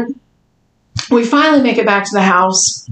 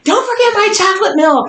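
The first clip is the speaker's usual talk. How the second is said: higher-pitched mock mom demand; 9 semitones higher than usual. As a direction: imitate mom making a demand. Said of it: "my mom was like".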